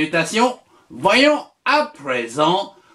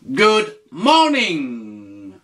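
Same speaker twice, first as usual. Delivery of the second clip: slow repeat for the learner; 2 semitones lower, much slower than usual. Shown as a demonstration. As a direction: repeat it slowly and clearly. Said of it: "tu lui dis".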